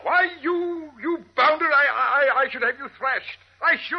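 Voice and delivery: indignant high sputter, stammering; sputtering outrage. Acted. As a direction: splutter with high-pitched old-gent fury. Old man sputtering at villain.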